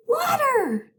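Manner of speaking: ordinary talk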